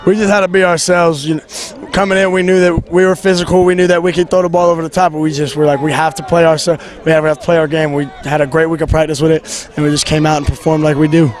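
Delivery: loud. Real, not acted. Shouting over crowd noise.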